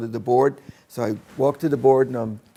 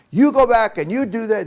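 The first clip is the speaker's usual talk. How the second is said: a much higher, louder yell; mother screaming; 9 semitones higher, 5 dB louder than usual. Retold or imitated. Italian mother ordering her son back to school, yelling. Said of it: "started screaming at me".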